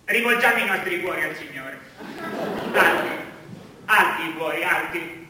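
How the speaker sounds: low, soft solemn liturgical intoning; priest at mass. Acted. Priest leading the faithful in a soft solemn chant. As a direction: lead the congregation like a drowsy priest.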